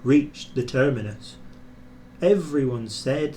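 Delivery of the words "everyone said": plain reading voice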